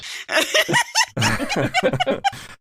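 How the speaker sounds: long high-pitched giggling; high giggle. Real, not acted. Big laugh.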